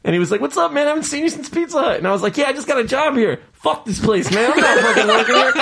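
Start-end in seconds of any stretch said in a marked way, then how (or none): 0.4-2.0 high, fast friendly greeting; bright buddy hello, quickly; imitating old coworker. Old coworker greeting him at Acme, high and fast. 2.4-5.5 higher, laughing fuck this place; laughing retort. Retold or imitated. Telling his old coworker he quits, laughing and higher.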